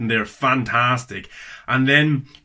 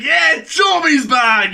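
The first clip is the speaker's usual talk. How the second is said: excited shout; 14 semitones higher, 6 dB louder, much slower than usual. Retold or imitated. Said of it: "I'm like".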